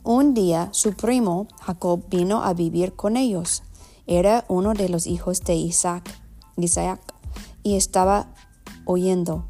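Spanish with an American accent. Real, not acted. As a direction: read Spanish as an English speaker. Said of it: "perdona mi español".

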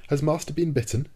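picture your master hurt and ask fast; surprised worry. A narrator's reading. Conseil fears his master was bitten, alarmed. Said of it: "he asked in surprise".

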